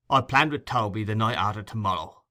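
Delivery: ordinary delivery, nothing marked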